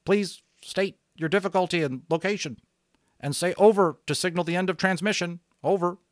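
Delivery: radio operator. Acted. Radio operator telling an unknown caller to release the switch, brisk. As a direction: sound like a pilot on the net. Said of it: "The voice was very faint and breaking up".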